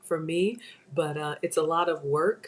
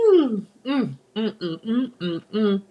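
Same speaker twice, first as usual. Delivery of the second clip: savoring hums; 3 semitones higher, slower than usual. Real, not acted. Savoring bacon.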